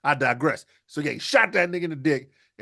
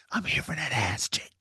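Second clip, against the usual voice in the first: creepy dad murmur; 7 dB quieter than usual. Acted. Dad sneaking in on son.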